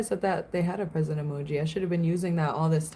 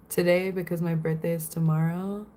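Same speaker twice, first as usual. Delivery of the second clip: usual speaking voice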